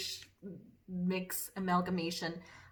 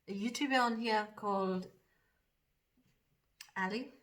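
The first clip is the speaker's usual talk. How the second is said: put-on lightly done Geordie accent, a bit fast at first; chatty Geordie accent while doing makeup; a hint of Geordie accent in casual chat. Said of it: "that's about as much of the accent".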